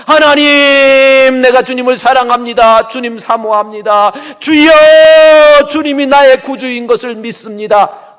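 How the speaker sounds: loud, high, drawn-out opening call; preacher voicing Asaph, loud and high; passionate prayer. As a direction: cry out your love to God.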